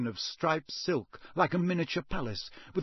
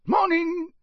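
"Morning" much higher and louder, a rustic countryman; gruff Muggle; 7 dB louder than usual. Acted. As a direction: answer like the wary campsite owner. Muggle campsite owner answers greeting.

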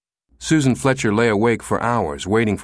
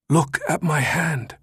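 dying man's plea; 7 semitones higher, slower than usual. Acted. Dying man shows his ring.

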